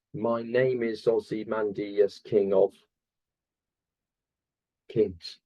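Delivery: slow, syllable-by-syllable reading with a long hang before the last word; measured monotone count through the line. Shown as a demonstration. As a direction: count out each syllable slowly and evenly, then pause before the final one. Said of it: "There's one extra syllable in that line".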